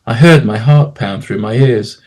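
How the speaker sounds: ordinary delivery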